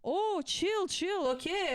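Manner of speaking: imitating a bratty student waving off the teacher; dismissive student. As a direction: brush it off like a snotty kid.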